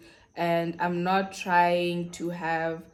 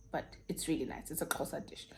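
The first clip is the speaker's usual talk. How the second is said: whispered aside; 3 semitones lower, 13 dB quieter, faster than usual. Real, not acted. Whispered aside about the dish.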